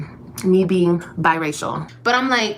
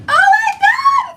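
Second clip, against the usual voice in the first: high shrieking laugh into oh my God; laughing fit; 4 dB louder, slower than usual. Real, not acted. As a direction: crack up loudly, can't hold it in. Bursts out laughing at own remark.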